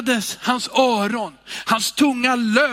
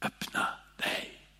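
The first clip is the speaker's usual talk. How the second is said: whispered, soft; quiet; 13 dB quieter than usual. Acted. Jesus speaking softly to the deaf man, whispered. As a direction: say it barely above a whisper. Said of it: "han talar tyst till mannen".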